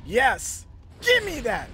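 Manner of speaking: loud, high, fierce cheer; triumphant shout. Real, not acted. Triumph at landing a hit.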